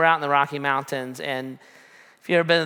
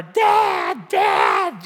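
loud, drawn-out imitation of calling for Dad; hammed-up distress yell; yelling for help; 8 semitones higher, 5 dB louder than usual. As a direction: mimic a panicked child yelling for a parent. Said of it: "she started yelling for".

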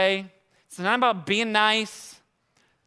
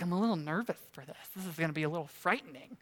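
much softer, quicker nervous murmur; nervous wife; 3 semitones lower, 9 dB quieter, faster than usual. Retold or imitated. Wife nervous on the drive to ziplining, soft and quick. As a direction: quietly confess the nerves. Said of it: "Sarah's like".

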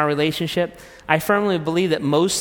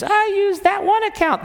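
wife's voice. Acted. Acted marital quarrel, wife.

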